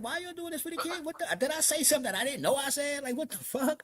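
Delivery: high-pitched retold bafflement; worked-up, suspicious disbelief; incredulous questioning. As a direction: question it high, unable to believe it.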